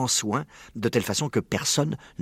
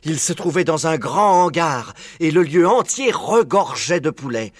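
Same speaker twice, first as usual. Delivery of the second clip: louder, higher, stretched-out storytelling; wonderstruck narration; 7 semitones higher, 4 dB louder, slower than usual. A narrator's reading. Narrator reveals a hangar full of chickens, marvelling. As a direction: build in amazement, drawing words out slowly.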